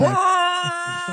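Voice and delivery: sudden high shout of burn; mock shout. Friend yelling burn at the doctor. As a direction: yell it like a roast. Joke shout mid riff.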